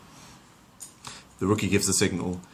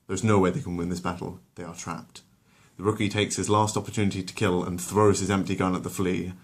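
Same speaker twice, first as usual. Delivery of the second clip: ordinary delivery, nothing marked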